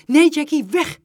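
loud, high urgent warning; sharp, high-pitched command; alarmed shout. A narrator's reading. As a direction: yell to send her away. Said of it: "riep hij".

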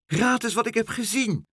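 high, excited call; excited cry. A narrator's reading. Dolly flies up with news for meneer Pen, excited. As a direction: burst out with big news. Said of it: "Ze was erg opgewonden".

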